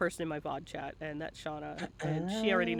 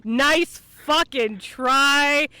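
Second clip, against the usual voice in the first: slow, loud, higher teasing jab; mock yell; 6 semitones higher, 10 dB louder, much slower than usual. Host calling out the guest's lie, slow mock yell. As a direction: drag out the insult as a joke.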